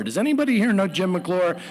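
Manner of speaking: ordinary delivery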